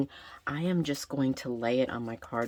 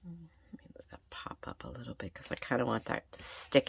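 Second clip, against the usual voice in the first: whispering; 3 semitones lower, 10 dB quieter than usual. Real, not acted. Quietly talking to herself while crafting.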